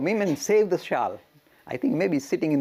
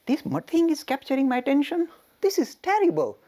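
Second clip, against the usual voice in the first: much higher and louder, scandalised; rising into horrified indignation; outraged outburst; 11 semitones higher, 4 dB louder than usual. Retold or imitated. Ramakrishna furious the shawl caught his attention, outraged outburst.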